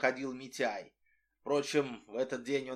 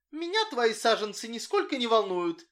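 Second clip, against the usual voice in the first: indifferent; 7 semitones higher than usual. A narrator's reading. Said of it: "равнодушно сказала Сонечка".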